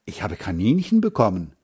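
ordinary delivery

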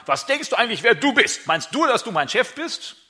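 annoyed worker to younger brother, mock outrage; indignant retort. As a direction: snap back at the little sibling. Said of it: "dann würdest du eher sagen".

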